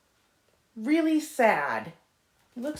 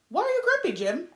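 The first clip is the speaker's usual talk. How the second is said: Marabou asking; 8 semitones higher, faster than usual. Acted. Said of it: "asked Marabou".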